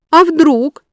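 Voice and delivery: pressing, high and loud; won't let it drop; insistent. A narrator's reading. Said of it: "не унималась я".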